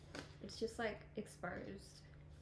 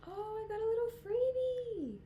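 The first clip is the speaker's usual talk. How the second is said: louder, way up high, delighted; a thrilled little shriek; high excited squeal; 13 semitones higher, 6 dB louder than usual. Real, not acted. Surprise at a free gift.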